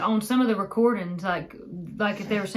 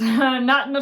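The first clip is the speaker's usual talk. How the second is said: ordinary talk, nothing marked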